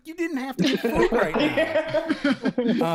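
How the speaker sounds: loud, high, slow laughing scold; exasperated. Retold or imitated. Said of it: "I'm like".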